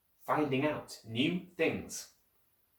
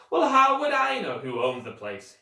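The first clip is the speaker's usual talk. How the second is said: louder, higher exasperated; gruff postman retort; 10 semitones higher, 6 dB louder than usual. A narrator's reading. Postman brushing off the children, exasperated. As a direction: brush off the question.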